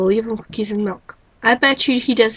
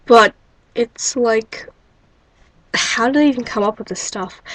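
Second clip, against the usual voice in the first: normal talking voice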